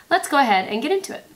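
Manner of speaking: robot voice. Real, not acted. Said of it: "That just sounded like a robot".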